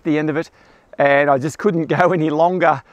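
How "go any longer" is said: usual speaking voice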